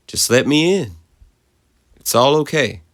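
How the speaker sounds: high, gentler soothing mother; gentle coaxing. A narrator's reading.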